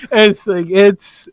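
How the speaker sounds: ordinary talk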